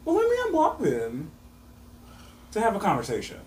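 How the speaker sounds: high, sweet innocent voice; lightly hammed-up innocence; mock innocent. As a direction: pretend it's all so reasonable. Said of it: "So I was like".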